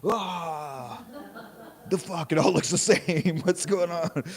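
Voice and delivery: high, loud, laughing bewilderment; lost in the station, voice climbing; lost and panicking. Retold or imitated. Lost on the subway platform: high, loud, bewildered panic. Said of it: "I'm like".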